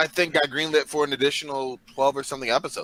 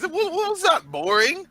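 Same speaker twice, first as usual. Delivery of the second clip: high indignant disbelief; mock outrage; 13 semitones higher, slower than usual. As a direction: pretend to be shocked by the word.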